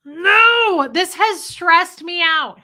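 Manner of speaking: loud, high, drawn-out protest; exasperated outburst. Retold or imitated. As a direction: wail it out, stretching the words. Retells exasperated outburst at cat.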